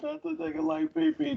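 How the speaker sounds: high, soft, strained squeak; squeaky, hushed disbelief; amused high squeal. Real, not acted. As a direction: squeeze it out high and quiet, tickled by the joke. Cracking up, repeating the joke.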